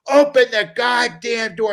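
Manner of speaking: high, loud furious father; angry shout at a locked door; retold angry yell. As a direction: hammer the words like a raging dad. Dad demanding, most angry.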